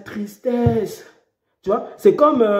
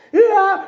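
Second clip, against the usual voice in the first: high pretend cry of terror; a short staged scream; mock crash scream; 10 semitones higher, 3 dB louder than usual. Preacher acting a crash victim's scream.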